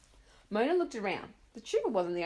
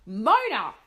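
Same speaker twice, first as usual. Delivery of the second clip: very loud call of the name; mother shouting outside a blocked door; 5 semitones higher, 11 dB louder than usual. Acted. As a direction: hammer the name through the door.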